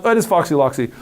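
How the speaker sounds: ordinary delivery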